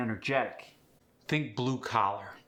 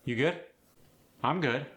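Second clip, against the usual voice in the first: short, higher Philadelphia-accented exchange; pitched-up Philly greeting; direct Philly attitude; 4 semitones higher than usual. Shown as a demonstration. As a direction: keep it familiar and direct, Philly style. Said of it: "just direct".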